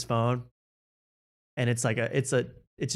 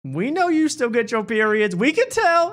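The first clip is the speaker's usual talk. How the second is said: lightly voiced hype comic, higher; comedian crowd work; 11 semitones higher, 4 dB louder than usual. Cat Williams addresses the ladies, lightly voiced hype. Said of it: "but he goes".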